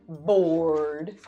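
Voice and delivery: ordinary delivery, nothing marked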